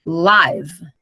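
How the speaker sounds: Live said to rhyme with "five"; "Live" with an "eye" vowel, falling; long-i pronunciation. Shown as a demonstration. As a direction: say "Live" as in a live broadcast. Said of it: "Aí a pronúncia já é outra".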